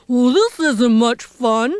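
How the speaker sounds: miserable elephant grumble; grumbling. Acted. Poor elephant sitting in storm, grumbling. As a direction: grumble it out, put upon. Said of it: "The poor elephant grumbled".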